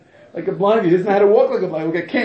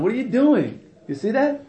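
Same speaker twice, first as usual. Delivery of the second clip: normal talking voice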